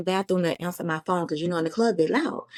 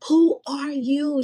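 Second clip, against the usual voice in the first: high, slow mock question; 8 semitones higher, 4 dB louder, much slower than usual. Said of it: "when she said".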